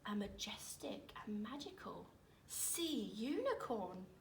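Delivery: softly, breathless with wonder; hushed reveal of a magical creature; awed whisper. A narrator's reading. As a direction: whisper it like a secret marvel.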